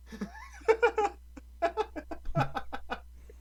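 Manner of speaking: long, high-pitched laughing fit; helpless laughter. Real, not acted. Host laughing after the sandwich impression, helpless laughter. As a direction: crack up at your own bit.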